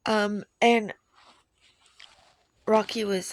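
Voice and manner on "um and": ordinary talk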